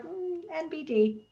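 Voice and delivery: high, soft, drawn-out brush-off; mock nonchalant. Teammate imitating the cook shrugging off his meal, nonchalant. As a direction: toss it off slowly as nothing special. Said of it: "Paul was so nonchalant about it".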